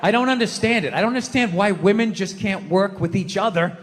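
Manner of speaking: normal talking voice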